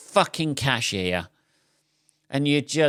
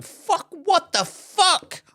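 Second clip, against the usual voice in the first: much higher, slow, drawn-out outburst; mock outraged shout; 4 dB louder, much slower than usual. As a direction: yell it like a shocked customer. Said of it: "people going".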